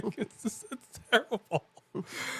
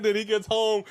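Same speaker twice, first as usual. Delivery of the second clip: ordinary delivery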